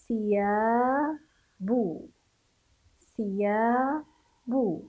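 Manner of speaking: "ثياب" stretched very slowly, the ث with the tongue out; slow sounding out. Shown as a demonstration. Teacher sounds out the word for children, very slow. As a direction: draw out "ثياب" syllable by syllable. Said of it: "نقرأ".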